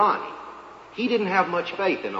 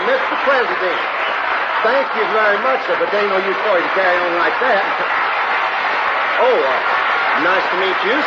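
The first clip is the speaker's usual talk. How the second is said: fast, louder, laughing protests to the crowd; flustered modesty; 3 semitones higher, 4 dB louder, faster than usual. Acted. Janitor begging world leaders not to carry him, flustered and laughing. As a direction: wave off the fuss, bashful and giddy.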